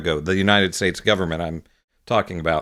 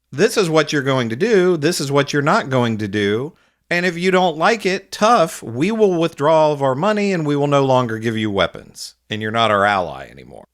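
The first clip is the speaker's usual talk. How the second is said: forceful ultimatum; 4 semitones higher than usual. Pretend. Imagined ultimatum to Israel.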